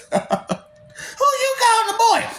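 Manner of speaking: mock indignant. Mock outrage at being called boy, high and slow. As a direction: play it like you're insulted.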